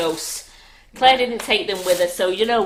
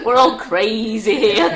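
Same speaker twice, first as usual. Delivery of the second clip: crazy villain; 8 dB louder, slower than usual. Acted. Hamming up evil laugh.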